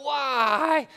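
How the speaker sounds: high, loud mock scream; a pastor echoing a frustrated caller's high, loud cry; screamed why. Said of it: "and even when he just screams out".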